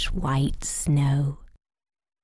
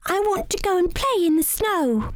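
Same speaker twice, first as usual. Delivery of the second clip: put-on high, pleading child voice; eager little rabbit; 14 semitones higher than usual. A narrator's reading. Young rabbit asking mother to play outside, high and pleading. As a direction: beg like a small child to go out. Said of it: "cried Barnaby".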